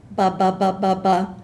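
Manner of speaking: short detached ba syllables; short choppy bus sounds. Shown as a demonstration. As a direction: bounce each ba short like a bus. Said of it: "is used to demonstrate the short".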